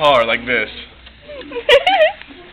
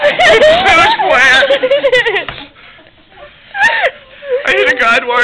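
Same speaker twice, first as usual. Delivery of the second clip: laughing; 5 dB louder, slower than usual. Real, not acted. Laughing realization.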